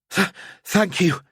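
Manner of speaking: high, shaken stammer; stammered thanks. A narrator's reading. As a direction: stumble over the thanks in fear. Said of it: "she stammered".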